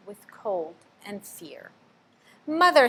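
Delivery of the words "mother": high, loud squeaky cry for mother; a lost baby bat calling out; frightened squeak. A narrator's reading. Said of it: "Stella Luna squeaked".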